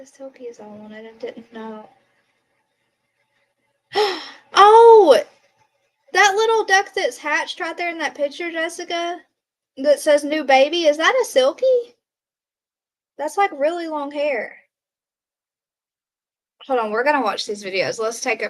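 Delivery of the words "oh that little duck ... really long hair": delighted gasp. Real, not acted. To her viewer about the baby duck, high delighted gushing.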